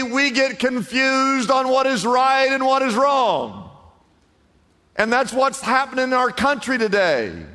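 high, loud preacher's build; fiery preaching. Acted. Pastor preaching the fall to the congregation, full force. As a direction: drive the story home from the pulpit.

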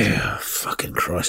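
low, slow, muted groan of disgust; weary exasperation. Real, not acted. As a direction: drag it out under your breath. Weary disgust at media figures discussed.

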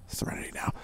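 breathy whispered serenity now; whispered mantra. Acted. Cohost calming himself after being scolded, whisper. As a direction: whisper it to calm yourself. Sighing self-calming catchphrase.

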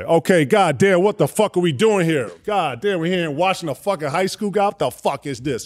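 mock annoyed coach; imitating Pat Riley. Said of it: "I heard Pat Riley".

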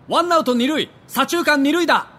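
大きな声での宣言; loud declaration. Retold or imitated. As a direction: announce it to the whole field. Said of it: "大きな声で宣言".

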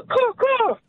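high squawking caw caw; bird caw call. Shown as a demonstration. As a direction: screech it like a bird. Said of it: "can you do the sound one more time".

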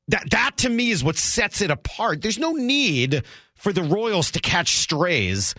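usual speaking voice

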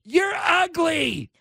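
very high, drawn-out jeer; imitated girl's yell. Girl across the street heckles the man, high yelling. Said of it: "some girl ... yells out".

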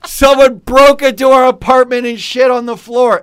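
high, loud, slow hammed-up horror while laughing; mock shocked outrage. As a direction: play the outraged victim of a break-in. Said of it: "I did the".

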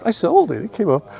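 high-pitched insistent correction; excitedly retold rebuttal; retold protest. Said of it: "I go".

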